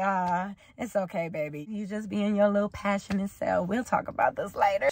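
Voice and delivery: soft, low imitation of a tender partner; soothing girlfriend. Voices girlfriend soothing her partner.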